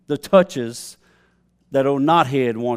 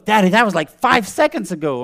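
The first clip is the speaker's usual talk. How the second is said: daughter's protest; 5 semitones higher, 5 dB louder than usual. Retold or imitated. Daughter protests in a retold spat.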